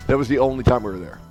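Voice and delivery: ordinary talk, nothing marked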